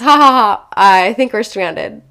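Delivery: imitated husband laughing it off; loud spoken ha-ha, then slowing into the admission; mock laughing. Husband breaking bad news to wife, imitated laughing delivery. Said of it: "starts laughing, he goes".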